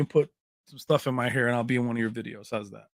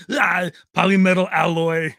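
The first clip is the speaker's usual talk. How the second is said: mock Austrian Arnold accent, louder; Arnold impression; 3 semitones higher, 7 dB louder than usual. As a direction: ham up the Terminator line like Arnold. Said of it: "Oh, you know, Arnold".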